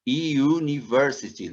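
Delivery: slowed "University" with a Y glide; model pronunciation. Shown as a demonstration. Teacher repeats "university" for students, Y glide. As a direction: stress the Y at the start of "University". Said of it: "uma letra Y na frente".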